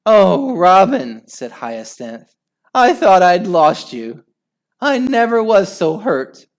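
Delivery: wife greeting her husband back from death; emotional relief. Acted. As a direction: pour out relief at his return.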